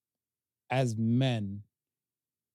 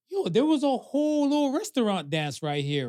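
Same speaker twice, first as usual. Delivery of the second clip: high, eager wondering; amazed inner thought, pitched up; excited; 8 semitones higher than usual. Retold or imitated. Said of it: "I'm like".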